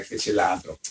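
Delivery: ordinary delivery, nothing marked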